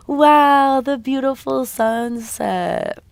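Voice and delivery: high, slow, drawn-out wow; awed wonder. Acted. Awed at the view in the story.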